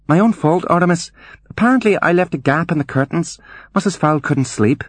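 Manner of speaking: put-on high-pitched girl's voice, hurried excuse; high voiced maid explaining herself; startled Juliet.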